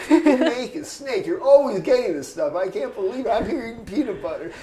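high-pitched, exasperated imitation of griping; mock complaining. Roommate griping about peanut butter dinners, high mock complaint. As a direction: whine loudly like a hard-done-by roommate. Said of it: "he was complaining".

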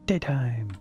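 Daytime in a sudden high, bright-pitched call; playful high voice. Pretend. Streamer toying with the light switch, calling Daytime up high. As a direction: flip up high for Daytime like a little announcement.

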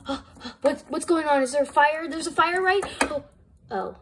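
usual speaking voice

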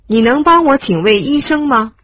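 ordinary talk, nothing marked

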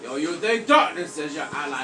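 hushed, deep caped-vigilante impression; Batman. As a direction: drop deep for a playful superhero threat.

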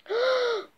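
high sudden gasp of discovery; sharp gasp. Acted. Amelia realising the truth, sharp gasp.

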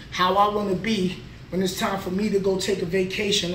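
usual speaking voice

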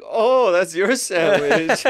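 suddenly realizing it's his sandwich; delighted realization. Retold or imitated.

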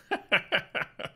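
quick airy chuckle; breathy laugh. Real, not acted. Laughs at own joke.

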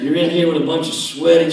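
ordinary talk, nothing marked

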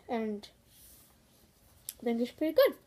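speaking past a mouthpiece; talking with mouthguard in. Real, not acted. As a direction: mouth around a guard. Seen 3: Tethered guard visibly in mouth under helmet facemask while speaking.

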